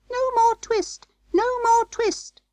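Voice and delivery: very high, singsong mouse squeak; little mouse voices. A narrator's reading. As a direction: chant it as tiny twittering mice. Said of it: "little mouse voices singing".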